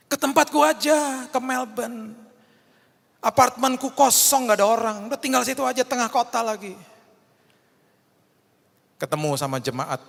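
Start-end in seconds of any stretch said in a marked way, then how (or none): 0.1-6.8 high, loud enthusiastic offer; eager friend. Retold or imitated. Wife's friend eagerly offering her Melbourne apartment, high and loud. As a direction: insist warmly they come stay.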